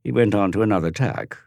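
ordinary delivery, nothing marked

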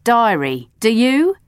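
stern, cutting sarcasm; a cross adult scolding with irony; sarcastic friend scolding the girl; severe and sarcastic. A narrator's reading. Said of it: "very sarcastic when she's cross".

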